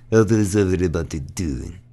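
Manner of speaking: put-on thick Russian accent comic greeting; old Russian lady. Old Russian comic greeting the crowd, Russian accent. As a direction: imitate an old Russian woman opening her act. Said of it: "this old Russian lady".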